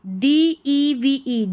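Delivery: normal talking voice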